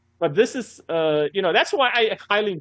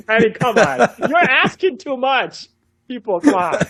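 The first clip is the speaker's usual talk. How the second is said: laughing; 8 semitones higher, 5 dB louder than usual. Real, not acted. Laughing at the Dutch pronunciation demand.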